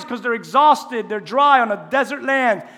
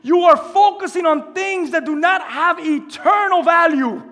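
preaching shout; 6 semitones higher, 4 dB louder than usual. Retold or imitated. Preacher almost screaming at the distracted church, loud urgent cry. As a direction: plead with the church at full force. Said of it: "He's almost screaming to the church".

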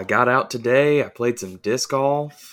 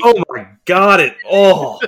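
loud disgust; 7 semitones higher, 9 dB louder than usual. Real, not acted. Disgust at taste.

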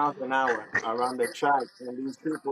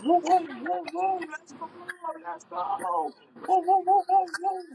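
much higher playful woo-woo cheering; mock hype whooping; 13 semitones higher than usual. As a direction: hype yourself up like a rider ready to race. Cheering himself on at track.

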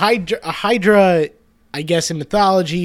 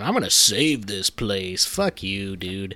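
jaded sneer; 7 semitones lower, 6 dB quieter, slower than usual. Retold or imitated. Voicing jaded townsfolk mocking.

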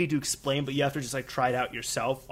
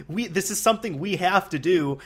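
usual speaking voice

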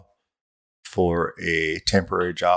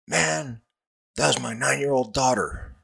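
high mock captain voice, still worked up; yelling captain; 7 semitones higher than usual. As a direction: play the captain right after a phone tirade. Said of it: "And he's like".

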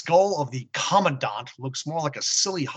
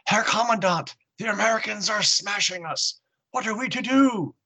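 higher, frantic soldier crying out; panicked soldier cry; 7 semitones higher than usual. Acted. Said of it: "points at the carnage and cries".

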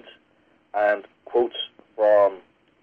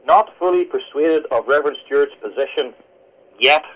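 "yet" set apart after a pause; emphasis falls on the final "yet."; stress on yet. Shown as a demonstration. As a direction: hold back, then land "yet" on its own as the key word. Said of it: "with a slightly different emphasis".

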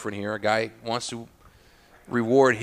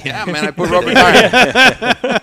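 slow, higher and much louder goofy customer voice; imitated customer; 9 semitones higher, 13 dB louder, much slower than usual. A cigar fan bragging about his rubber tire taste, hammed-up slow goofy voice. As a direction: ham up a clueless cigar fan, slowly.

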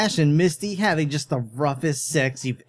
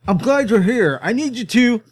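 forced relief; 7 semitones higher, 4 dB louder than usual. Acted. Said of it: "forced sound of relief".

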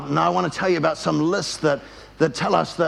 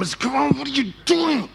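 high, exasperated outburst; upset driver; 7 semitones higher than usual. Retold or imitated. Driver upset at the van holding up traffic, acted exasperation. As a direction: re-enact losing patience, pitched way up. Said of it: "I'm getting upset".

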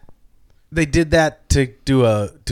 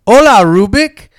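high, loud, slow cartoon voice; loud cartoon greeting; 6 semitones higher, 7 dB louder than usual. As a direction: belt out a cheery cartoon hello. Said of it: "And then they go".

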